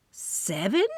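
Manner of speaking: "Seven?" as a stunned rising question; shocked question. Acted. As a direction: ask "Seven?" in disbelief, rising. Narrator echoes in shock.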